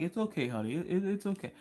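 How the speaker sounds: soft mock coo to a grandchild; soothing grandpa. Grandpa soothing his wild granddaughter, soft coo. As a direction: coo it gently. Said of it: "Pawpaw's just like".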